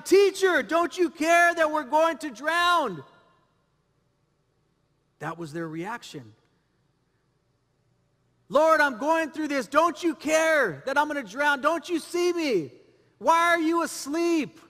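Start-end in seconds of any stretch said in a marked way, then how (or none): 0.0-3.0 loud, high-pitched cry of protest; panicked disciples' shout. Acted. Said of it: "The disciples woke him up shouting". 8.5-14.6 raised, high-pitched cry to God; desperate plea. Acted. Acting out desperate prayer in storm.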